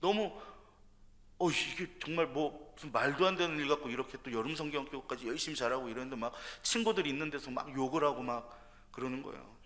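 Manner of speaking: usual speaking voice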